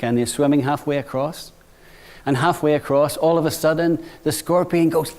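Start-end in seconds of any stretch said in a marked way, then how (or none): none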